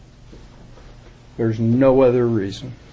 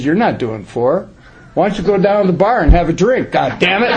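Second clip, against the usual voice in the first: louder, faster, higher mock scolding; 5 semitones higher, 3 dB louder than usual. Old sponsor scolding a slacking newcomer, loud and fast. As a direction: bark it at him like a fed-up sponsor.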